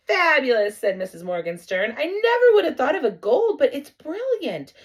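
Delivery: enthusiastic. Acted. Mom enthuses over gold.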